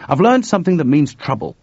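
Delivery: urgent news of trouble. Acted. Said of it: "said Chuck urgently".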